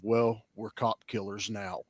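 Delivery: low, slow, soft mock-dramatic delivery; dramatic movie line. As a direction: intone it like a grim tough-guy film line. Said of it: "that dramatic line of".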